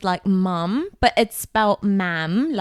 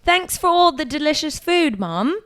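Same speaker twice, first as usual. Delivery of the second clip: British accent demo; 5 semitones higher than usual. Shown as a demonstration. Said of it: "You gotta say that one".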